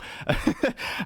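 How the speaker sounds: high unvoiced giggle; quick airy laugh; breathy giggle. Real, not acted. Laughs at eating on camera.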